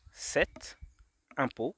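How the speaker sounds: "cet", pause, "impôt" lifted at the end; hidden words separated. Shown as a demonstration. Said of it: "il y a également la phrase". (« cet » puis « impôt », bien séparés)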